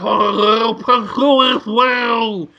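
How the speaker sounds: slow, garbled sea-creature voice; Merman. Acted. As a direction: drag it out like a cartoon fish-man. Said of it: "Merman's like".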